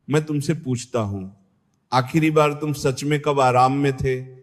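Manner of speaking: ordinary talk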